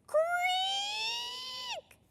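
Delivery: long, high squeal of wood; spinning wheel creak. A narrator's reading. Henry brushes the spinning wheel, long high creak. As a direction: draw out a piercing, soft creak.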